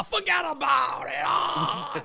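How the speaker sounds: hammed-up Italian wiseguy shout, drawn out; mock an undercover cop overplaying an Italian accent. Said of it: "the stereotype of an Italian".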